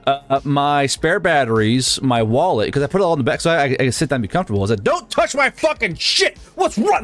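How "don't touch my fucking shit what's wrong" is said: high-pitched indignant scolding; retold army-style outburst. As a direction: re-enact chewing someone out, voice jumping way up. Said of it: "I kinda went mildly army on him".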